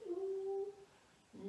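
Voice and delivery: high, soft "ou" like a howling wolf; imitating a wolf's howl. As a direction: howl "ou" for the children. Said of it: "imiter le loup".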